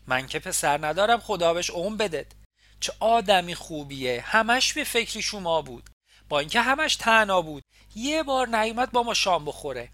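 put-on Isfahani accent. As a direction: gush about the boy in broad Isfahani. Landlady praising Nima in dialect.

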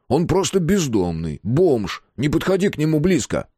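high, exasperated plea; understated desperate warning; despairing. A narrator's reading. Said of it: "взвыл в отчаянии Мэтт".

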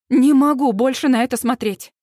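ordinary delivery

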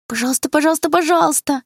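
high, whiny begging like a little sister; pleading. Acted. Little sister nagging her brother to show the photo, whiny pleading.

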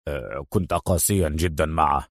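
low, soft, uneasy murmur; tense mumble. A narrator's reading. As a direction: murmur it tensely, deep and hushed. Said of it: "فغمغم نيكولاس متوترا". (بغمغمة متوترة)

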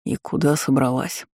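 low, sleepy male voice. A narrator's reading. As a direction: ask it half asleep, voice low. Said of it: "сонный голос".

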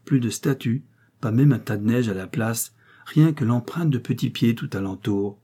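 ordinary delivery, nothing marked

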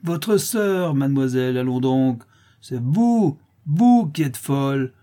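high, jeering comeback; coarse retort. A narrator's reading. Widow scoffing at Clara's word sister, coarse retort. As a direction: throw it back rudely with mockery. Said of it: "répondit grossièrement la veuve".

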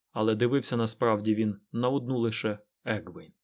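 ordinary delivery

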